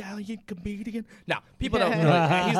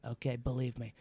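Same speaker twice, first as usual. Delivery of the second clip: Trump impression; 5 semitones lower than usual. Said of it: "so that's a little".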